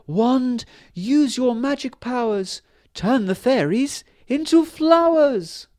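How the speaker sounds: very high, loud, slow spell; fairy queen. A narrator's reading. Fairy queen commands her wand to save the fairies: very high, slow. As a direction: cast the spell with urgent command. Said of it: "she said".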